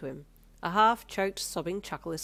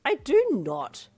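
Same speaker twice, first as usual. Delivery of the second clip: higher, slow, louder mock offence; offended snort; 8 semitones higher, 10 dB louder, much slower than usual. A narrator's reading. Daughter protesting dad's teasing, mock offended. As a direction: deny it with playful indignation. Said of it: "you snorted with low-key offence".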